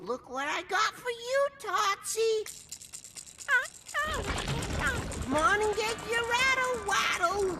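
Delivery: put-on high cooing baby talk. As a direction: coo like to a toddler. Max coos to monster baby.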